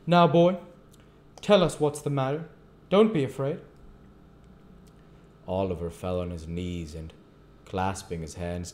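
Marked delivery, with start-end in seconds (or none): 0.1-3.6 high, loud urging; interested magistrate. A narrator's reading. Magistrate urging a frightened boy to speak, interested, high and loud. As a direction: coax the boy in a raised voice. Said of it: "with an expression of interest".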